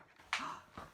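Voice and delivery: sharp storyteller's gasp; quick, audible intake of breath. Acted. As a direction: gasp in suspense for the listeners. Storyteller gasps before the turn.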